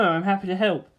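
usual speaking voice

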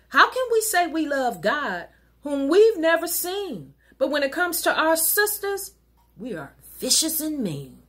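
normal talking voice

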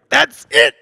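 explosive, high-pitched outraged shout; cracking it. Retold or imitated. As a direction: blow your top in a comic retelling. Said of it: "Uh, I cracked it".

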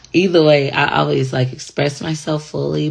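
ordinary talk, nothing marked

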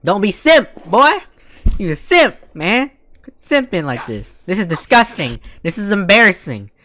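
high, loud taunting; mocking yell. Real, not acted. Streamer yelling at a simping anime character, taunting. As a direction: razz him hard.

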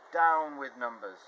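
loud, slow yelled chant. Acted. Brothers and sisters turning on Spinderella, yelling. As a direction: yell it together at her, slowly. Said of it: "they yelled".

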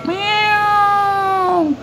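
high drawn-out meow; cat meow. Pretend. [name] imitating a cat on request. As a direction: mew like a cat, long and high. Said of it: "bisa menirukan suara kucing".

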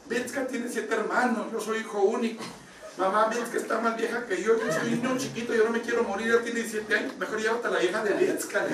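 imitated whiny-child plea, no real tears; retold crying child. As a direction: bargain like a scared child, played for laughs. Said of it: "llora y llora el pobrecito".

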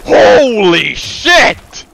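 slow, high, loud shocked yell; startled shout. Real, not acted. Player startled by an attack, slow loud shocked yell. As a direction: drag it out in alarm.

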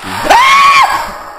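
sudden terrified scream at a jump scare; real startled shriek, very high and loud. Real, not acted. Jump scare in horror game. (grito de susto real)

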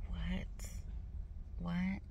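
very soft, almost whispered "what?"; hushed disbelief. Retold or imitated. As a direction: say it under your breath, stunned. Stunned reaction to yesterday.

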